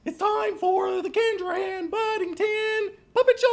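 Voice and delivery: very high, excited puppet announcer; puppet show announcer. Pretend.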